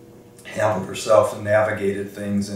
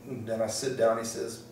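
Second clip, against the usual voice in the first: ordinary delivery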